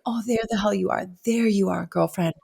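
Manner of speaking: soft, affectionate self-greeting; hushed relief; tender relieved greeting. Retold or imitated. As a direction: murmur it fondly, like finding a lost friend. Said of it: "for me, it was a breath of fresh air".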